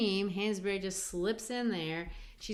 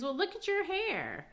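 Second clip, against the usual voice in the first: higher, teasing comeback; teasing young man's retort; Assegai voiced; 11 semitones higher than usual. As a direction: point at her hair with a playful jab.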